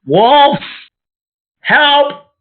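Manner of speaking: loud, high-pitched call for help; yelled cry for help. Acted. Player's character calling for help against wolves, yelling high. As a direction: cry wolf at the top of your register. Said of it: "And I yell".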